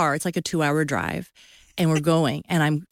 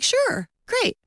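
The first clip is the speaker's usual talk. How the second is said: breezy agreement. Retold or imitated. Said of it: "And he's like".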